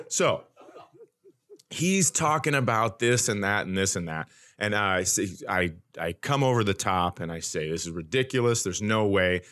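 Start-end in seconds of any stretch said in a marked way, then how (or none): none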